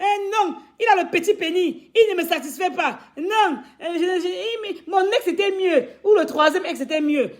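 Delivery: a hint of mocking woman's voice; mocking a fickle woman. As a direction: parody her griping about the men. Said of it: "elle va venir dire que".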